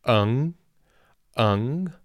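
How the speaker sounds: "eng" with a plain, open e before ng; "eng" said as in northern China; northern eng ending. Shown as a demonstration. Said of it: "they say".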